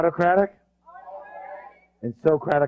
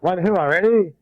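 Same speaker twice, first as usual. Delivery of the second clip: rallying cheer; 4 semitones higher, 4 dB louder, slower than usual. Real, not acted. Leading the audience in a cheer.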